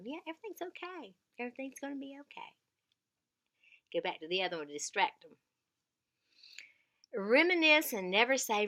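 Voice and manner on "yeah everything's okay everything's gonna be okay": soft, quick soothing reassurance; reassuring. Shown as a demonstration. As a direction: comfort them softly. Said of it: "Reassure them".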